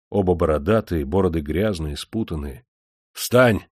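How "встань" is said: higher, louder barked command; shout. A narrator's reading. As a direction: order him up sharply. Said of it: "крикнул Демидов Кержаку".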